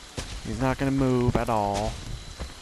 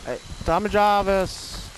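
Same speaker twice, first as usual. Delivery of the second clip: calling out; 9 semitones higher than usual. Real, not acted. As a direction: shout his name to flush him out. Calling out to hunted player.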